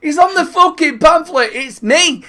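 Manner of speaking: high, loud, half-laughing insistence; mock indignant protest. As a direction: protest loudly as if refused at the door. Retold protest at being refused entry.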